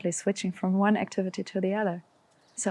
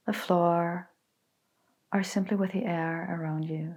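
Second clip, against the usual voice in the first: calm, unhurried meditation voice with long pauses; guided meditation. Acted. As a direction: guide them gently into stillness, letting phrases settle. Leads a guided body scan meditation.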